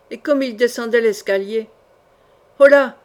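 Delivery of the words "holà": loud hail down the stairs; calling out. A narrator's reading. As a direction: shout to stop them leaving. Calling after men on stairs. (appel lancé à pleine voix)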